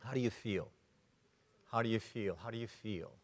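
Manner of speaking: soft, low repeated question; quiet demo question. Shown as a demonstration. Trainer demonstrating the feeling question, soft and low. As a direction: drop it down and ask gently. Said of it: "The word feel".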